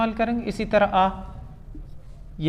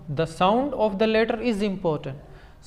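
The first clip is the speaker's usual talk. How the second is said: slow emphatic rule; much slower than usual. Shown as a demonstration. Teacher stressing the key rule.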